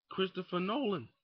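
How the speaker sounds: ordinary talk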